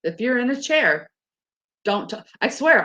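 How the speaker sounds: ordinary talk, nothing marked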